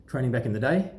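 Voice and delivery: ordinary talk, nothing marked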